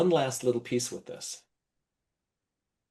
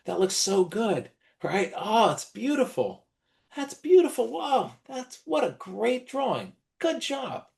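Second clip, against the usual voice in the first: high, gushing grown-up praise voice, much higher; gushing praise to a child; 7 semitones higher than usual. Shown as a demonstration. Teacher gushing over a child's drawing, high sing-song praise. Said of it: "usually what we do is we say".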